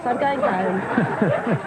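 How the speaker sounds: put-on very slowly drawn-out British accent. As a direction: stretch out I'm going home in a British accent. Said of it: "is that a real accent".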